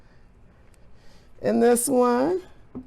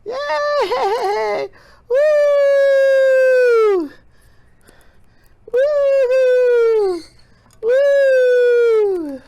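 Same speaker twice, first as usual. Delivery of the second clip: cheering whoops; 12 semitones higher, 4 dB louder, much slower than usual. Real, not acted. Cheering the lava lamp.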